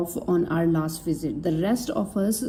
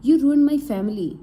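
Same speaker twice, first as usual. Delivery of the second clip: angry accusation; 6 semitones higher, 5 dB louder, faster than usual. Acted. Betrayed man accuses Ramesh.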